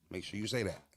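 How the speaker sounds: ordinary talk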